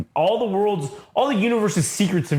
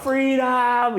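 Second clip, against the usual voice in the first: high, long-held cry of release; mock triumphant cry; 10 semitones higher than usual. Freed man runs out, mock triumphant cry.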